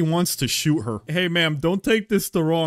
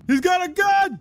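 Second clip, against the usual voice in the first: much higher, full panic shriek; panicked shriek; 3 dB louder than usual. Real, not acted. Gamer repeats the warning to chat, now a panicked shriek. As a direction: squeal it out in alarm. Enemy pulls a gun.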